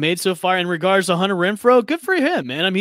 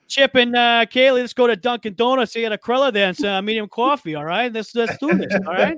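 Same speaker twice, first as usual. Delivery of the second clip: hammed-up Boston accent, higher, laughing at the end; thick Boston accent, playful; 6 semitones higher than usual. Host inviting friends to Dunkin' Donuts in a hammed-up Boston accent. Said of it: "in a Boston accent".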